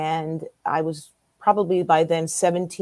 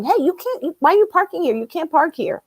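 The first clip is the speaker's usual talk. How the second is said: alarmed friend; 8 semitones higher than usual. Retold or imitated. Retells friend objecting to parking.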